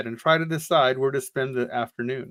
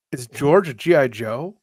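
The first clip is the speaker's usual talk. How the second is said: ordinary talk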